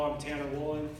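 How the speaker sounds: normal talking voice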